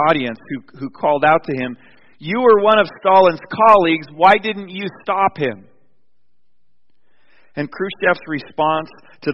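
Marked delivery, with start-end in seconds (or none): 2.2-5.7 heckler shout. Retold or imitated. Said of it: "a shout from a heckler".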